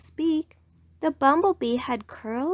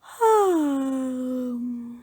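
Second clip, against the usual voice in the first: big sleepy yawn; 3 semitones higher than usual. Acted. Rabbit yawning.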